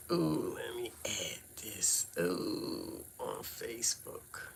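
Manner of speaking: a quick breathy aside, half to herself; murmured note while she adds the link; whispered aside. Real, not acted. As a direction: whisper it to yourself.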